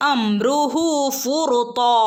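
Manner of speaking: slow, chanted verse reading; Quran recitation. Shown as a demonstration. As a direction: recite it slowly like in class. (പതുക്കെ ഖുർആൻ പാരായണം)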